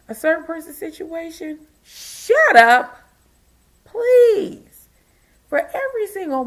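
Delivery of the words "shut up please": loud rant. Real, not acted. Ranting at unwanted commenters.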